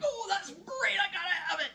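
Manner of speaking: high, gushing squeal of desire; mock shopper's excitement. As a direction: gush as if you must buy it. Acting out impulse shopper in store.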